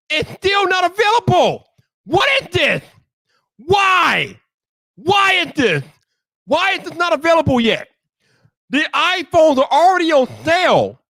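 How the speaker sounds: high-pitched, drawn-out exasperation; annoyed rant. Real, not acted. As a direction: rant in slow, emphatic bursts. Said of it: "I gotta express some annoyance".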